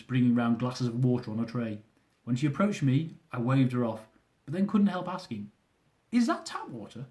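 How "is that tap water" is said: high, doubtful question; wary question. A narrator's reading. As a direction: ask it warily. Narrator asks waitress warily.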